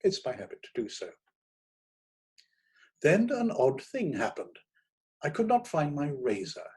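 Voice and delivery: plain reading voice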